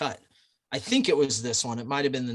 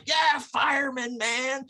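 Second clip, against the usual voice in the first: much higher, slow drawn-out bar cheer; cheering shout; 10 semitones higher, slower than usual. Retold or imitated. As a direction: whoop it across the bar at the firemen.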